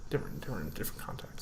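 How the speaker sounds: very soft, low mutter; muttered aside. Real, not acted. Friend muttering about a different context after a mix-up, muttered. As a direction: repeat it under your breath.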